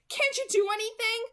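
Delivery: high, loud, desperate question; anguished. A narrator's reading. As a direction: beg the doctor in anguish.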